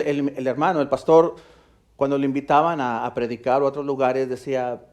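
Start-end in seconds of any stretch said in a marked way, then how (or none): none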